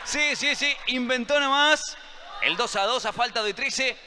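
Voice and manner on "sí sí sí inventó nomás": high-pitched cheering call; excited shout. Real, not acted. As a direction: cheer the equaliser. Commentator celebrates equaliser.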